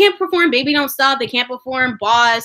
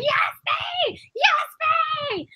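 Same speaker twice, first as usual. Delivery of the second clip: fangirl yell; 4 dB quieter than usual. Retold or imitated. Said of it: "I was yelling".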